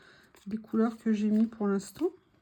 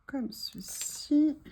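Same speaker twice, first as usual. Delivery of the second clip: slow breathy whisper to herself; hushed, drawn-out aside; 4 semitones higher, much slower than usual. Real, not acted. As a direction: whisper it slowly, barely voiced. Slow whispered aside while arranging.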